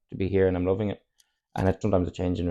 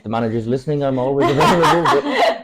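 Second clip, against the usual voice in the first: laughing it off, louder and higher; 6 semitones higher, 7 dB louder than usual. Real, not acted. Footballer joking to the Man United manager, laughing. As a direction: chuckle through a cheeky offer.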